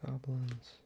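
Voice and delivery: ordinary delivery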